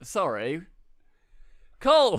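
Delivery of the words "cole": loud high call of the name. Real, not acted. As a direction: shout the name across the room. Calling out to co-host.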